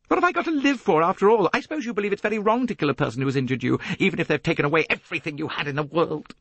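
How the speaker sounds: high, rushed, bitter challenge; heated outburst. A narrator's reading. Jacqueline arguing her grievance to Poirot, heated and bitter. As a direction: pour it out fast and high.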